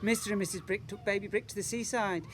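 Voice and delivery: ordinary delivery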